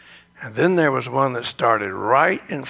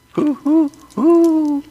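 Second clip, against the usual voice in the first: high, louder owl hooting; 13 semitones higher, 5 dB louder, slower than usual. Pretend. Back to the high owl call for the listeners. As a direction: hoot up high and push it out. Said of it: "Aren't I good?".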